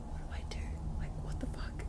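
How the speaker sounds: hushed panicky whisper; scared whisper. Retold or imitated. Retelling her frightened whisper in the dark hallway, hushed and scared. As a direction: whisper it like you're afraid to be heard.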